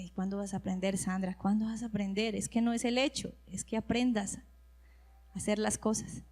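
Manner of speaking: soft, low, patient teasing; God's gentle voice. Retold or imitated. God gently chiding Sandra, soft and low. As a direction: gently ask when she'll learn. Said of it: "Decía".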